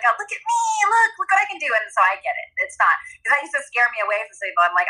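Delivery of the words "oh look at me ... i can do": put-on high childlike look-at-me voice; show-off child. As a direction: brag like a kid wanting praise. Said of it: "but it's like".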